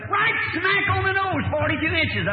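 a hunting guide stunned that the horns measure exactly right, amazed high cry; amazed exclamation. Retold or imitated. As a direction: blurt it out, stunned the vision came true.

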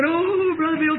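high, drawn-out mock wail; imitated wailing. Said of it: "she's just crying like that".

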